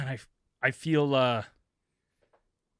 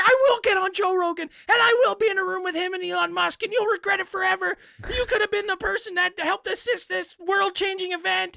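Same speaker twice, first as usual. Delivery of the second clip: much higher voiced threat; mock indignant caller. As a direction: proclaim it as the wronged visionary. Voicing angry caller.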